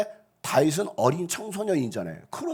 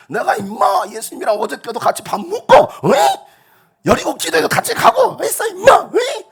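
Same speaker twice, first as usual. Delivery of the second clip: much higher, louder swaggering brag, laughing through it; laughing thug bluster; 10 dB louder than usual. Pretend. Preacher hamming up a bold believer, loud mock gangster. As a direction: brag like a neighborhood tough. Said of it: "하면서".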